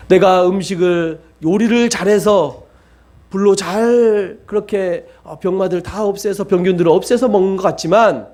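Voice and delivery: ordinary talk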